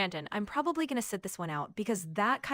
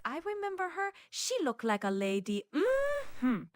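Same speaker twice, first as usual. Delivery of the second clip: sing-song sketch-comedy character voice; Miss Swan; 6 semitones higher than usual. Acted. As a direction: swing the pitch up and down like a sketch character. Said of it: "But the Miss Swan thing".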